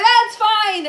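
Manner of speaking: high, strained "it's okay"; embarrassed brush-off. Retold or imitated. Woman just turned down by bartender, high embarrassed brush-off.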